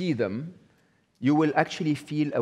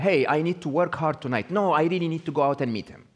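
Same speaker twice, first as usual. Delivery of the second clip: higher-pitched, lightly acted inner debate; arguing inner voice; 5 semitones higher, 3 dB louder than usual. Speaker voicing a woman torn over working tonight, lightly acted inner argument. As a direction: argue with yourself, quicker on the objection. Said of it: "does she sometimes say".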